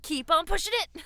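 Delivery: high, loud gym pep shout; hype coach voice. Retold or imitated. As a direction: fire up a lifter. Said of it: "you being like".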